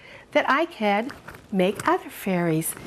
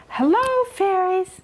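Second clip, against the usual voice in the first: high, lilting sing-song hello; fairy bridge greeting; 10 semitones higher than usual. Shown as a demonstration. Showing children the greeting for the fairies, high and sing-song. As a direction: call it up high and sweet to the fairies. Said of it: "if you do say".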